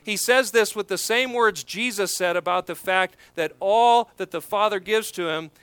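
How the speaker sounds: normal talking voice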